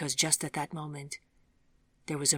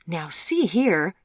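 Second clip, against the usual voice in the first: louder, slow indignant objection; getting upset; 2 semitones higher, 8 dB louder, much slower than usual. A narrator's reading. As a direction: object with rising annoyance. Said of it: "getting upset now".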